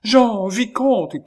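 high, loud, drawn-out call; cried out. A narrator's reading. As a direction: cry out frantically, stretching the names. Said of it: "criait mademoiselle Chon". (cri aigu, fort et prolongé)

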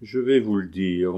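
ordinary delivery, nothing marked

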